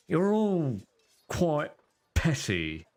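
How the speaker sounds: sniffy, looking down on them; lightly voiced disdainful noble; haughty sneer. Mortlock voiced by the GM.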